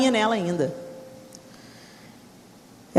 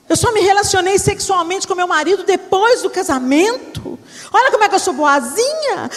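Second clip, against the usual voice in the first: self-righteous protest; 7 semitones higher than usual. Retold or imitated. Daughter lists her virtues to God, high and indignant. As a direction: list your virtues indignantly.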